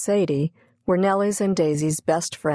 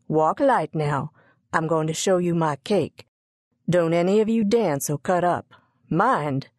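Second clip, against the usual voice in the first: ordinary delivery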